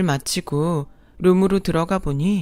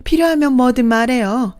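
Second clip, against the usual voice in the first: gloating second mother; 5 semitones higher, 4 dB louder than usual. Acted. Second mother gloating to the wife, sickly sweet. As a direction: gloat with fake generosity.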